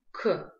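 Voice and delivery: «к» с заметным выдохом; произнеси «к», выпуская воздух следом; к с придыханием. Shown as a demonstration. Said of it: "мы произносим с придыханием". («к» с придыханием)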